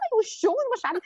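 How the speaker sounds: strained high yell, re-enacted at modest volume; mock panicked screaming from the sidelines; retold frantic yell. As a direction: imitate a frantic high-pitched holler. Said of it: "我在旁边疯狂的呐喊".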